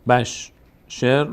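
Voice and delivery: بشر stretched out very slowly; slow syllable blend. Shown as a demonstration. Teacher stretches بشر slowly for students. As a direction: glue بش to ر, very slowly. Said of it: "اینجا ر ساکن داشت".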